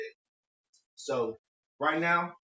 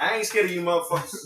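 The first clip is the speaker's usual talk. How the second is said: Bernie Mac impression; 4 semitones higher, 6 dB louder than usual. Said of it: "What was Bernie Mac say?".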